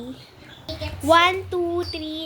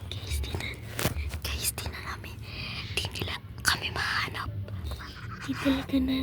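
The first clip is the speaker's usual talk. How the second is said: gleeful hushed whisper; whispering while hiding; 7 dB quieter than usual. Real, not acted. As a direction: whisper so the seeker can't hear. Hiding, seeker can't find them.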